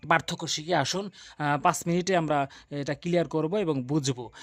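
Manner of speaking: usual speaking voice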